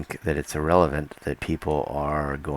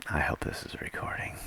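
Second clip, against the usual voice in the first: whispered aside to himself; hushed check under the breath; 9 dB quieter than usual. Real, not acted. Vlogger to himself, wondering if camera runs, whispering.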